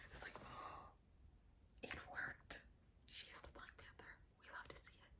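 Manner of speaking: hushed, breathless whisper; awed whisper. Real, not acted. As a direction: whisper it in awe, barely voiced. Awed hush at the suit.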